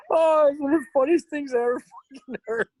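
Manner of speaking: high-pitched laughing speech; laughing hard while talking. Real, not acted.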